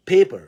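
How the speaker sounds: paper with loud breathy P at the start; paper, pop both P's with air; aspirated P. Shown as a demonstration. Teacher giving paper as a P example. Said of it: "وزي كمان".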